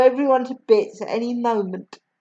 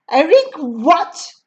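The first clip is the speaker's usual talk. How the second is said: slow, mildly louder demand; shouted; 5 semitones higher, 3 dB louder, slower than usual. Acted. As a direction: demand it slowly. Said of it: "the Trunchbull shouted".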